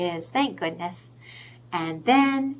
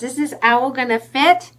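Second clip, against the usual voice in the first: usual speaking voice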